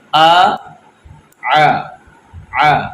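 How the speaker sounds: teacher sounding آ three ways; three letter sounds. Shown as a demonstration. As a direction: contrast each آ from the throat. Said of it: "فرق محسوس کیجئے".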